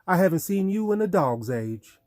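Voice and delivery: playful fake Southern Belle greeting; faux Southern Belle accent. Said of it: "his best faux Southern Belle accent".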